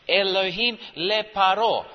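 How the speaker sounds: Elohim le Paro read out in Hebrew, ending with the stress on Paro; Hebrew phrase. Shown as a demonstration. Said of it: "it's written like this".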